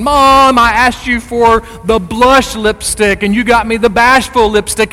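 put-on high, loud teenage-style tantrum at Mom; angry daughter. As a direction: whine and complain loudly to Mom. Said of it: "mad at mom and saying".

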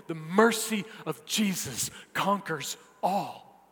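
emphatic preacher, voice lifted on the key words; impassioned preaching. Real, not acted. Preacher telling believers they are now His, impassioned. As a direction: drive each phrase home from the pulpit.

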